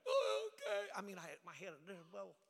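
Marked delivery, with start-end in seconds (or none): 0.1-0.9 very high, small mock voice; meek surrender. Young preacher answering his screaming mentor, mock-meek. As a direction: give in meekly, high and thin.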